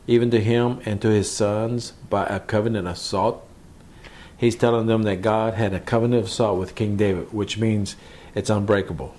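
ordinary talk

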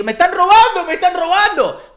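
loud, high cry for help; mock panicked shout. Victim crying out that he is being robbed, loud high shout. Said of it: "te pongas a los gritos o a decir".

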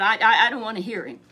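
loud, fast sulky refusal; stubborn refusal. Acted. Ahab refusing to hear Micaiah, loud and fast. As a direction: blurt it like a stubborn child.

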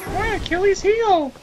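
squeaky pained yelp; high-pitched Goron voice. Pretend. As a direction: wail it in a squeaky voice. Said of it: "really high-pitched voice for a Goron".

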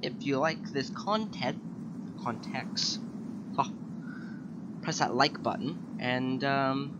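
loud upbeat sign-off; YouTube outro. Acted. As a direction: pitch the subscribe button. Outro call to subscribe.

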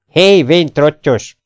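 loud, drawn-out insulting hail; kiáltás. A narrator's reading. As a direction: yell slowly after the old man. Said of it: "kiáltotta az a matróz". (utánakiáltva, gorombán)

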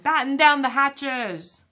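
slow, loud captain's order; captain shouting orders in a storm. A narrator's reading. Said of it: "Braidbeard shouted".

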